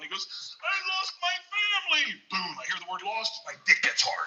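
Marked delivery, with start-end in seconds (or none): 0.6-2.2 put-on high, wailing little-boy voice; imitated crying child. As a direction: wail it like a lost kindergartner. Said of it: "freaking out, crying".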